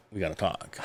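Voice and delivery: low, hushed, ominous summons; soft and low, after a heavy pause; grave grandma. Retold or imitated. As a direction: quietly announce a serious talk.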